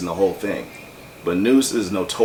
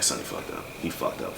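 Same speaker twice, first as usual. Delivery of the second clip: whispered, lower worried grumble; worried grumbling; 8 semitones lower, 10 dB quieter than usual. Retold or imitated. Fretting at work that his friend botched the message, low whispered grumble. Worrying at work about Sonny.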